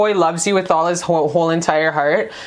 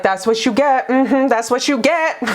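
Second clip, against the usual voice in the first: smug taunt; 5 semitones higher than usual. Retold or imitated. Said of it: "[name] stood by and was like".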